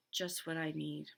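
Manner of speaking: ordinary delivery, nothing marked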